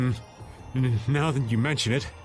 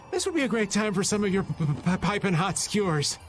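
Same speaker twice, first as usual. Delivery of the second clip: stuttering with cold; shivering. Acted. Freezing man asks friend for hot skewers, shivering stutter. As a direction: chatter on the first sound of piping.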